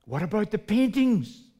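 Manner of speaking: high, loud disbelieving question; stunned buyer questioning the auctioneer; shocked buyer. Acted. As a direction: blurt it out in disbelief.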